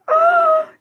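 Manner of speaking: high, loud startled gasp; sudden high gasp; sharp gasp. Real, not acted. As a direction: gasp as if shocked. Reacting to comment.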